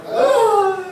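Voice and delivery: high, mock-whiny moan about the food; hammed-up grumbling about missing meat; whining complaint.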